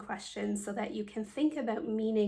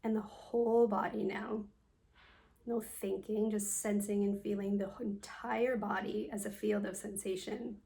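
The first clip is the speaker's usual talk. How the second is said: guided meditation. Acted. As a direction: guide them calmly through a body scan, leaving space after each phrase. Leads a yoga nidra meditation.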